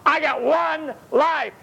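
slow, high, each word weighted; emphatic Jesus. Acted. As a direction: lay down "I got one life" slowly and firmly. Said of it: "Jesus let them know".

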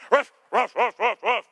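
loud high mock "ruff" barks, half laughing; dog barking. Pastor imitates Xena barking at an old lady, loud and laughing. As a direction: yap like a little dog, with a laugh.

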